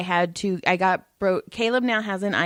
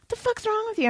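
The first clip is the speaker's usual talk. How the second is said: exasperated; 13 semitones higher, 4 dB quieter than usual. Retold or imitated. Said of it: "I was all".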